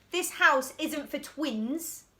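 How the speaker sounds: drawn-out, deliberate; boy refusing the twins, slow and bossy; slow bossy refrain. Acted. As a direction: spell out the rule slowly.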